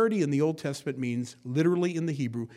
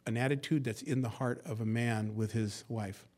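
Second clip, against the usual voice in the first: usual speaking voice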